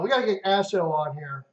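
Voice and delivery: ordinary delivery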